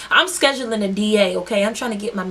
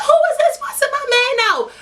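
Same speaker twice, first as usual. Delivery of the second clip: retold outraged shout. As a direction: shout it in disbelief, half laughing. Sees girl fussing at her man.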